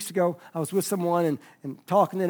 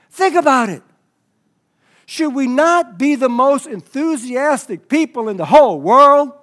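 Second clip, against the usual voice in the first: loud, raised preaching voice; impassioned exhortation; 6 semitones higher, 10 dB louder than usual. Real, not acted. Preacher urging church to be enthusiastic, impassioned and loud. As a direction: challenge the room at full force.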